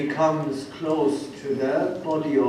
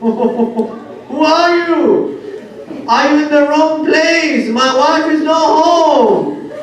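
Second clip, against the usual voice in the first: high, loud, slow mourning cry; lamenting wail; 10 semitones higher, 7 dB louder, much slower than usual. Acted. A preacher voicing Abdullah to his wife, wailing. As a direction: stretch each word in grief.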